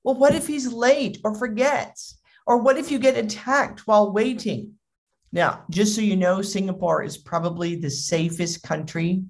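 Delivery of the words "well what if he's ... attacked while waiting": higher, fretting mom voice; acted overprotective worry; really worried. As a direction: imitate an anxious mother's what-ifs. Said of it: "really worried".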